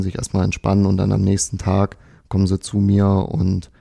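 usual speaking voice

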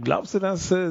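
ordinary talk, nothing marked

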